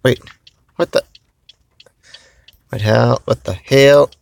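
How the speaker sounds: surprised. Real, not acted. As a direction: caught off guard, blurting it out. Phone acting up suddenly.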